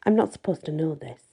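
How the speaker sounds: plain reading voice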